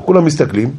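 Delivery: usual speaking voice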